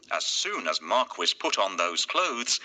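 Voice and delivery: usual speaking voice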